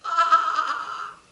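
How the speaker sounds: loud, high bleating cry; high-pitched goat holler; goat's bleat. A narrator's reading. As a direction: bleat out a long, loud "aah". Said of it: "her only comment was".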